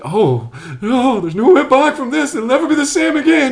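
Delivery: high, loud mock panic of an overhyped fan; mock fan hysteria. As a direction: ham up a breathless fanboy gasping at a plot twist. Mocking fans online.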